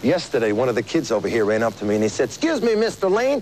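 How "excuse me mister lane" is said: put-on kid's Southern accent. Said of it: "the Southern accents".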